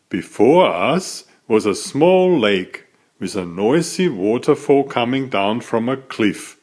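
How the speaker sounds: usual speaking voice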